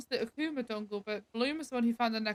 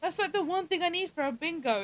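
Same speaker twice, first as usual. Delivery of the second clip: frustrated; 7 semitones higher than usual. Real, not acted. Missing a bingo square.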